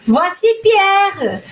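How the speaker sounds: voici Pierre, slow and drawn out; slow demo. Shown as a demonstration. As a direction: stretch voici Pierre for learners. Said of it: "Olha outra forma aqui". (voici Pierre dito bem devagar)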